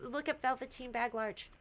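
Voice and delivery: usual speaking voice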